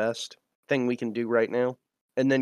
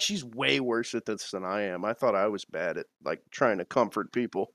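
low, hushed murmur; confidential aside; 4 semitones lower, 6 dB quieter than usual. Acted. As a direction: drop down low and soft to confide. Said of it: "I lean into Pryor and I'm like".

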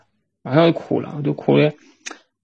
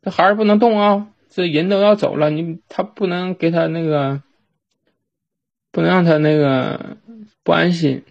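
stern aunt forbidding; 4 semitones higher than usual. Retold or imitated. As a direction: stop the child, imitating an anxious aunt. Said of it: "我当时我大娘说".

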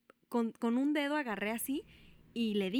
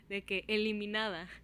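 usual speaking voice

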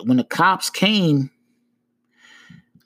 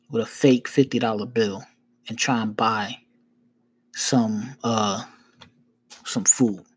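low, measured, with long pauses; talking like this; 4 semitones lower than usual. Retold or imitated. Man explaining himself to a cop, low and deliberate. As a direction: spell it out patiently, piece by piece. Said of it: "I'm talking to him like this".

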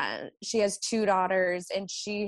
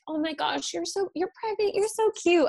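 much higher, cooing and gushing; gushing patient; 10 semitones higher, 4 dB louder than usual. Retold or imitated. Nurse imitating a patient cooing over her pregnancy, high and gushing. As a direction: coo over her like a sweet fan.

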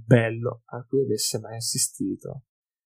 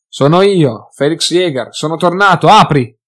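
loud, higher call through a door; 5 semitones higher, 11 dB louder than usual. A narrator's reading. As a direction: shout to be let in. Felix calling through locked door. (Felix chiama a gran voce dalla porta)

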